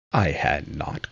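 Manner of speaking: ordinary delivery, nothing marked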